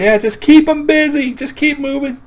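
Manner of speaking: very high mock grandma voice; breezy calling out. Grandma calls to grandson chased by a beast, breezy high voice. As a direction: call it out high, as if no big deal. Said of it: "like this is no big deal".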